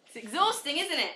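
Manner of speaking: breathless laugh through the words. Real, not acted. Instructor to class mid-plank, laughing. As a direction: laugh while holding the plank.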